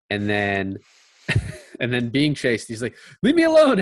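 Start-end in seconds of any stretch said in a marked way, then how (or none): 3.2-3.8 high, loud frantic yelp; mock panicked shriek. Retelling the brother fleeing after the mace, high frantic yelp. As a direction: squeal it like a cornered kid running away. Imitating Schwartzman fleeing the fight.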